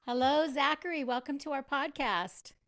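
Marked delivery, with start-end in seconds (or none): none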